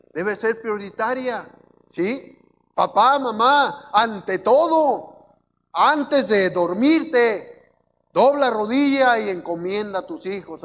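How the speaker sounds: loud, slow, hammered-out exhortation; full-force preaching. Real, not acted. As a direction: call out to the parents in a lifted preacher's voice.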